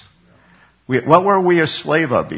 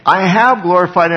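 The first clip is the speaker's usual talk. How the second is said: loud, higher, emphatic divine answer; God's voice from heaven; 7 semitones higher, 7 dB louder than usual. Acted. God answers Jesus before a crowd, loud proclamation. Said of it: "you hear that voice".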